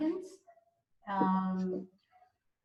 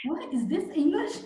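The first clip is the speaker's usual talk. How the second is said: laughing out the question in disbelief; amused, giggling through the words; 6 semitones higher, 4 dB louder than usual. Real, not acted. Friend laughing at strange Cockney English, laughing question.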